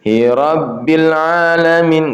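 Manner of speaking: "Hi Rabbil Alamin" pulled straight into the long vowel; wrong reading. Shown as a demonstration. Teacher demonstrating the mistake. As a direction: rush into "Alamin" without holding. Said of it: "nggak boleh gitu".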